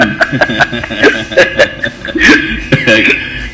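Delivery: long unvoiced laugh; breathy laughter. Real, not acted. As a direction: crack up in silent laughter. Host laughs at caller's goal. (risa ahogada y larga)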